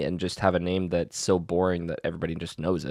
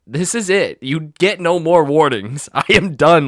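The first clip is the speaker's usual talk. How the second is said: higher, louder, fed-up telling-off; retold teacher outburst; 5 semitones higher, 6 dB louder than usual. Fed-up teacher warning students they get no more, re-enacted outburst. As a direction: re-enact laying down the law to students.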